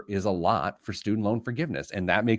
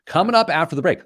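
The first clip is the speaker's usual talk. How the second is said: announcer voice; 7 semitones higher, 6 dB louder, faster than usual. Acted. Radio show break tease.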